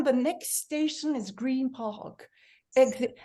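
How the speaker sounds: slow, prim posh English announcer; mimicking the posh recorded Tube voice; posh announcement voice. Teacher contrasts the posh Tube recording, slow and prim. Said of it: "we went from".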